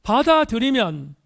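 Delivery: "받아들이면" driven out loud and high; loud, high attack on "받아들이면", falling at the end; emphatic repeat. Real, not acted. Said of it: "다시 강조합니다".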